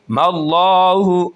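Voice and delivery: मल्लाहु slow with a full heavy लाम; heavy Allah. Shown as a demonstration. Teacher demonstrating the heavy लाम in Allah. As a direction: make the लाम heavy and round. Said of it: "उसको मोटा करके पढ़ेंगे".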